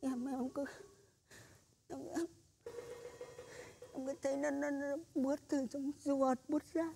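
a frightened near-whisper; scared hush. Acted. Terrified shaman telling the woman what he feels, hushed and halting. Shaman describing his fear.

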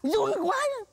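high, loud panicky retort; frightened outburst. Acted. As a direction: cry out in a fright. Thầy run sợ, đáp lại. (kêu to, cao giọng vì sợ)

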